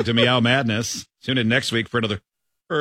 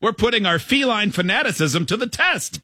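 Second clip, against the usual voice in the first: upbeat, higher radio announcer; radio host voice; 9 semitones higher than usual. Acted. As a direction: sell it like a morning DJ. Reads AI radio host script.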